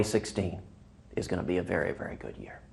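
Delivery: hushed, breathy closing whisper. Shown as a demonstration. Candidate closing his joke to the hosts, whispered. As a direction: drop to a secret whisper for the punch.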